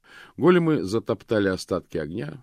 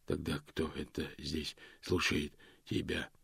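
soft, hushed stone monotone; 4 semitones lower, 11 dB quieter than usual. A narrator's reading. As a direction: ask quietly, without feeling. Golem asks Death. (тихий каменный монотон)

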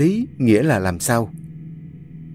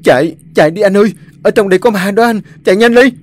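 frantic, high, loud and fast; panicked urging; 9 semitones higher, 7 dB louder, faster than usual. Acted. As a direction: scream at him to run. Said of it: "miệng thì thúc giục".

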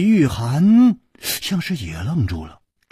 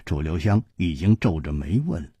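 plain reading voice

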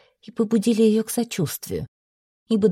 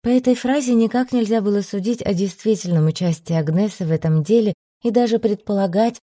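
ordinary delivery

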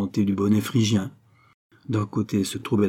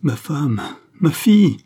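anguished cry rising to a loud, high peak; desperate cry; 6 semitones higher, 6 dB louder than usual. A narrator's reading. Count calls for his lost family, desperate cry. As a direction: cry out in despair, building on the second phrase. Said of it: "s'écria-t-il avec un accent impossible à rendre".

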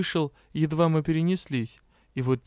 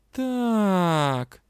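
higher, drawn-out doubt; doubtful drawl; 6 semitones higher, 3 dB louder than usual. A narrator's reading. Heroine answers the magister distrustfully. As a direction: stretch it out, distrusting. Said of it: "недоверчиво протянула я".

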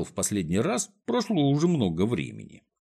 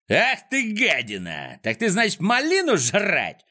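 angry yell; 9 semitones higher than usual. A narrator's reading. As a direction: yell at a caught thief, pitch leaping up. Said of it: "заорал Фикс". (гневный крик)

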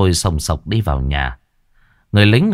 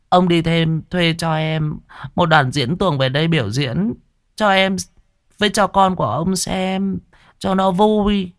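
wheedling wife; 9 semitones higher than usual. Acted. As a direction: sweet-talk him into it.

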